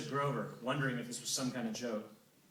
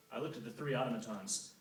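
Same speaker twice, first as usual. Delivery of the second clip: plain reading voice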